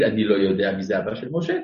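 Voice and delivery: low-pitched demonstration of ignorance; mock not knowing. Shown as a demonstration. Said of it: "לא צריך להגיד".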